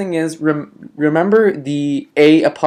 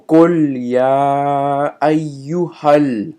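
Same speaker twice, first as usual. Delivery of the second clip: slow, chanted recitation; Quran recitation; 4 dB louder than usual. Acted. Recites the verse.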